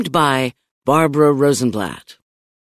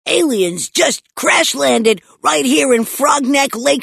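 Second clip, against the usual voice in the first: put-on high-pitched, exasperated kid; worked-up child protesting; indignant protest; 8 semitones higher than usual. A narrator's reading. Boy protests being told to wait.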